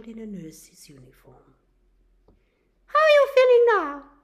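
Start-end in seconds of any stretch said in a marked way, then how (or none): 2.8-4.1 much higher, louder, fast nurse voice; bright bedside check-in; nurse asking. Acted. Nurse asks the revived patient how he feels, high and brisk.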